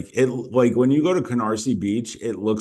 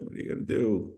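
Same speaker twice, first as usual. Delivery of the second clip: ordinary talk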